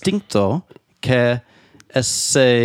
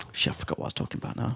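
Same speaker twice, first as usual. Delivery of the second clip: 1 muttered aside; 12 dB quieter, much faster than usual. Real, not acted. Forgets mid-conversation, mutters.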